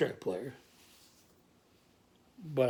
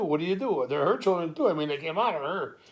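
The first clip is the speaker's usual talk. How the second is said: usual speaking voice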